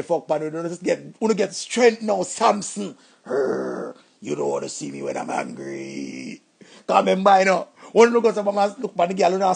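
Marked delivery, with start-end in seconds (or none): 3.2-6.4 very low, gravelly monster growl; hulk growl. Pretend. Man hyped up after the breakup, growling like the Hulk.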